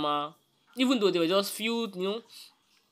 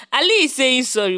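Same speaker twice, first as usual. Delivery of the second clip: loud, high, laughing announcement; laughing shout; 6 semitones higher, 10 dB louder than usual. Real, not acted. Host announcing the apology to fans, shouting through laughter.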